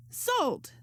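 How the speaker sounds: high, sharp call of Sold; cried out. Acted. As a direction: call the sale high and bright. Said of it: "the moment Marissa cried".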